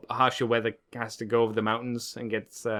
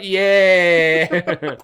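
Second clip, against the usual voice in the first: loud laughing yeah; laughing dad; 5 semitones higher, 10 dB louder than usual. Retold or imitated. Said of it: "Dad's like".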